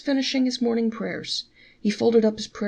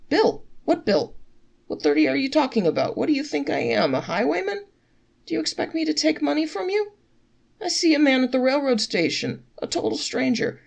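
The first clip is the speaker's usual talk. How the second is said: higher, mock-offended protest; indignant; 6 semitones higher, 3 dB louder than usual. A narrator's reading. Innkeeper protests to traveler, mock offended. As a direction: act wounded that anyone thinks you'd charge.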